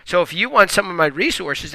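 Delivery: ordinary talk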